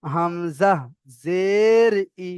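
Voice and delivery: long, swelling "জের", short "ই"; spelling demonstration. Shown as a demonstration. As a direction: recite slowly, stretching "জের" in an arch. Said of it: "দেখেন".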